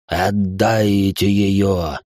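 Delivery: slow, low bubbling monster voice; gurgling tentacle alien. Acted. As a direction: gurgle it out through blood. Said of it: "пробулькало из головы".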